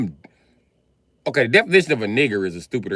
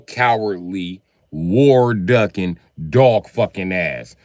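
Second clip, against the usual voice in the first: each insult slow and louder, hammered out; slow punched insults; 4 dB louder, much slower than usual. Acted. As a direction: drag out and hit every insult word. Slow insult build to Canadian.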